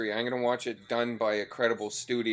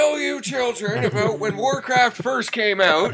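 put-on high, slow, half-hearted old man; a weak stab at an old geezer telling tales; 13 semitones higher, 5 dB louder, slower than usual. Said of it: "You're not doing the old man voice".

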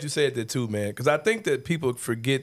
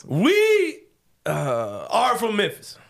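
We jumps up high, then a long pause before are from Memphis; dramatic little pause; 5 semitones higher, much slower than usual. Shown as a demonstration. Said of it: "Do the little pause".

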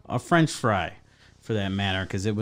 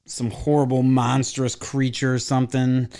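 ordinary talk, nothing marked

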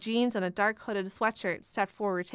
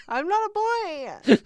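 high squeaky voice; 11 semitones higher, 6 dB louder, slower than usual. Acted. Joking, high mock voice.

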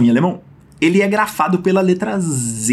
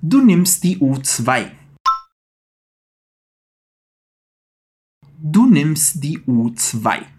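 slow drill; 3 semitones lower, slower than usual. Shown as a demonstration. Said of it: "capriche nesse som de tss".

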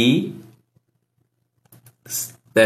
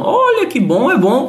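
pleased surprise; 10 semitones higher than usual. Real, not acted. Pleased reaction to chat.

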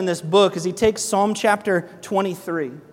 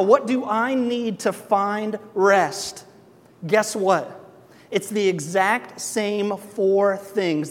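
slow emphatic preaching; 3 semitones higher, much slower than usual. Acted. Slow building sermon point.

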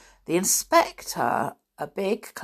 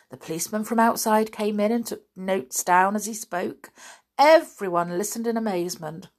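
ordinary delivery, nothing marked

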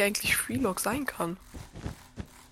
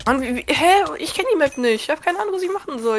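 flustered confusion; 9 semitones higher, 6 dB louder than usual. Real, not acted. Confused, lost on unknown map.

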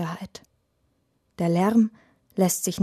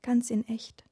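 tearful whisper; 4 semitones higher, 5 dB quieter than usual. A narrator's reading. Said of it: "mit weinerlicher Stimme".

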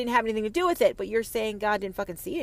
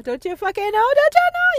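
rising shout; 11 dB louder than usual. Real, not acted. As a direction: climb higher and louder into a shriek. Mocking escalation.

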